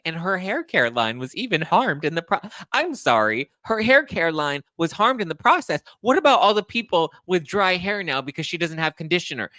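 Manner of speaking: high, mock-outraged rant; blood-boiling sarcasm. Real, not acted. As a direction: quote it back with scornful disbelief. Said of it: "my blood is boiling reading this".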